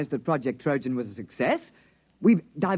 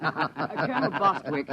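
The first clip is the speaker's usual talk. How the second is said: saying the name through a laugh; giggling greeting to the colonel; slower than usual. Acted. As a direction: chuckle as you greet the colonel.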